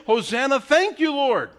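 high, loud grateful cry; praising God after being rescued, joyfully; joyful thanks. Acted. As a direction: burst out in thanks.

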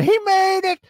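high, loud mock cry of joy; mock shout. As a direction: shriek it out like a mad fan. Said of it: "that madman".

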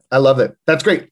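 ordinary talk, nothing marked

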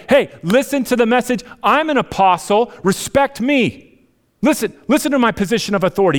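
high, loud, demanding voice of a bossy preacher; mock pushy apostle. Paul mocking a bossy apostle who demands respect. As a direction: throw your weight around, pulling rank.

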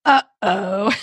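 a short angry growled uh-oh, barely sung. Shown as a demonstration. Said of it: "it more comes out like".

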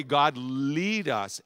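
slow, deliberate prayer. Shown as a demonstration. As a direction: lead us drawn slow. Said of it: "let me slow this phrase down".

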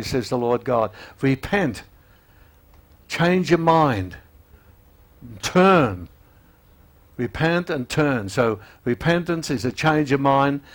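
fiery sermon voice with weight on each plea; preaching at full force. Acted. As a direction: press the call to repent home. Preaches the altar call.

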